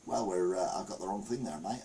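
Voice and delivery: lightly faked Aussie accent that sounds like a farmer; bad Australian accent. Answering the cobber, in a badly faked Australian accent. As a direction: fumble a put-on Aussie voice. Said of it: "You're talking like a farmer".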